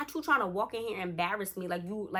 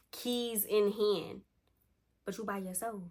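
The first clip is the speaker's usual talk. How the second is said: usual speaking voice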